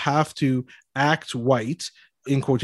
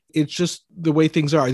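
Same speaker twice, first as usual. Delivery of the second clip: ordinary delivery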